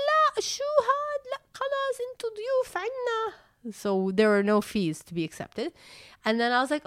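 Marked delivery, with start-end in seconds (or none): 0.1-3.3 much higher, insistent lady voice; school lady. Retold or imitated. School lady refusing the fees, high-pitched and fast. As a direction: squeak it out like a fussy school official.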